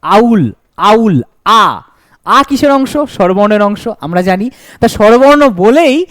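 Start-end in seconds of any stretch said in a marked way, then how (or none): 0.0-1.8 আউল stretched slowly so the opening আ rings out; teacher isolating the first sound of owl; drawn-out first vowel. Shown as a demonstration. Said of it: "প্রথমে কি রয়েছে?".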